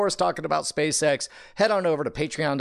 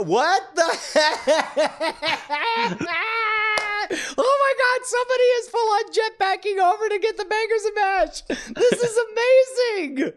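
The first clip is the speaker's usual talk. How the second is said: mock amazement. Watching somebody jetpack over for bangers and mash, mock amazed squeal.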